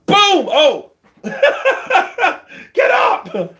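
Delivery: high, loud yelling with a laugh; excited. Retold or imitated. Reliving the knockdown as if ringside, high excited yelling. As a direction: shout at the fighter to get up.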